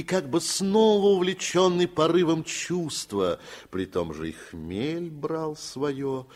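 usual speaking voice

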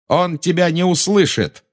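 high, defiant cry; Garri shouting. A narrator's reading. Boy tells the witch her master cannot hear, shouting. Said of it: "крикнул Гарри".

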